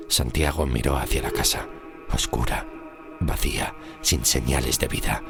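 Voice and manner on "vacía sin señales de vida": plain reading voice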